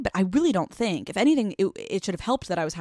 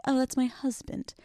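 higher, softer, dreamy gush; proud new wife; 5 semitones higher, 5 dB quieter than usual. Pretend. Imagining introducing Matt after the wedding, dreamy and gushing. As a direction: savor the word husband like a proud bride. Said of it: "It's gonna be crazy when I'm like".

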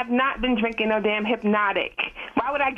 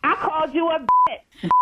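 angry; 5 semitones higher than usual. Real, not acted. Mother insults caller.

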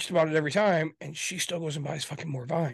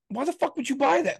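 much higher, incredulous outburst; exasperated; 10 semitones higher, 3 dB louder than usual. Retold or imitated. Husband retells snapping at his wife over the vine, exasperated. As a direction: throw up your hands at her.

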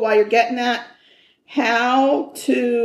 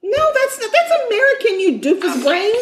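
excited; 12 semitones higher, 3 dB louder than usual. Real, not acted. Mocking the Google pronunciation.

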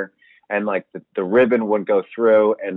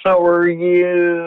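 Lisa Rinna; 8 semitones higher, much slower than usual. Retold or imitated.

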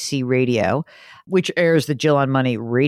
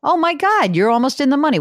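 much higher surprised exclaim; 11 semitones higher, 3 dB louder than usual. Real, not acted. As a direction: jump up in pitch, amazed. Surprised at stock price.

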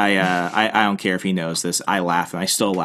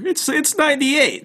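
much higher mock voice of a panicked friend; retelling a friend's call, imitating him much higher. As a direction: play the friend up high and fretful. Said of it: "what's your temperature?".

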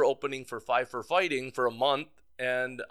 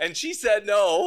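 laughing through the words, higher and louder; amused, laughing it out; 8 semitones higher, 8 dB louder than usual. Real, not acted. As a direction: crack up as you deliver the punchline. Laughing at wife's refusal.

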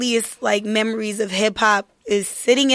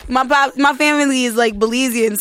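normal talking voice

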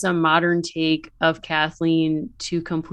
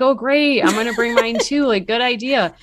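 mock eager mom; 6 semitones higher than usual. Mom cheerfully agreeing with her daughter, higher and brighter. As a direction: play the eager mom joining in. Mom voiced in retold story.

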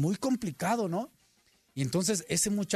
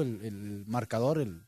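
normal talking voice

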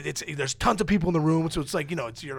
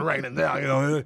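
usual speaking voice

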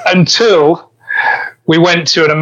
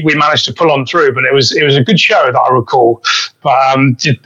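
normal talking voice

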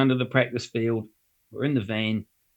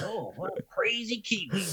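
mock tourist aside; 7 semitones higher, 9 dB quieter than usual. American tourist's soft verdict.

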